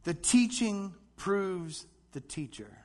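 slow and deliberate, each word set apart; slow recitation line. Shown as a demonstration. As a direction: say it slowly for the congregation to repeat. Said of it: "Repeat this after me".

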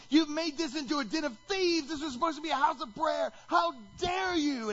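high, loud outraged rebuke; angry shout. Acted. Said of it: "he's like".

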